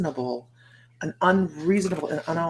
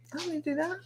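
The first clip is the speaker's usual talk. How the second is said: much higher, light and airy; carefree; 11 semitones higher, 8 dB quieter, much faster than usual. Retold or imitated. Deciding not to get up, airy and high. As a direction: float it up like you don't care.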